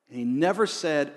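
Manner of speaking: usual speaking voice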